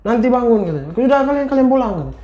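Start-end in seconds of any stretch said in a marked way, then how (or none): 0.0-0.6 high, insistent panicked voice; acted desperate refusal to believe; hysterical denial. A narrator's reading. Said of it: "Marah, histeris, panik". 1.0-1.9 high, frantic dismissal; storyteller acting a furious, hysterical wife; angry, hysterical. A narrator's reading. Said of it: "Marah, histeris, panik".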